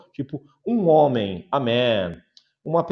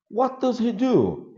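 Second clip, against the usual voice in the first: asking voice; 10 semitones higher than usual. Shown as a demonstration. Teacher asking model question.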